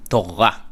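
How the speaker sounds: Torah with the R almost dropped; Israeli Torah. Shown as a demonstration. Teacher showing the faint Israeli R in Torah. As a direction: swallow the R in Torah. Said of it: "you don't actually hear it".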